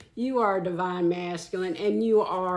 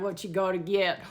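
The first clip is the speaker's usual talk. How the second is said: ordinary talk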